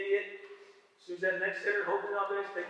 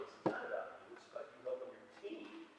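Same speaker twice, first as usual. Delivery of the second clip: softer, near-whispered reminder; hushed; 6 semitones lower, 11 dB quieter than usual. Shown as a demonstration. Coach quietly reminding his players it's about the team, hushed. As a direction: drop to a hush to drive it home.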